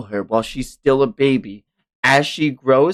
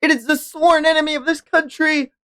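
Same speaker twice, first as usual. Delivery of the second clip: high, proclaiming denunciation; accusing official. Acted. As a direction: denounce the enemy before the court. Voicing accuser character.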